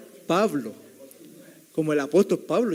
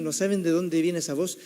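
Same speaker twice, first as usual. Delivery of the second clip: usual speaking voice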